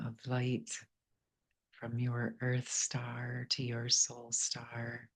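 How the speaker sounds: soft, unhurried meditation voice with long pauses; guided meditation. Real, not acted. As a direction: gently guide listeners inward, leaving space between phrases.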